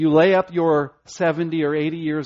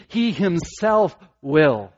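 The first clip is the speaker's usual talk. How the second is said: he himself will, slow, with he stressed and louder; emphasis on he; 4 dB louder, much slower than usual. Shown as a demonstration. Preacher stressing God's own work, slow and emphatic. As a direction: drive home that it is he. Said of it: "The emphasis is upon God".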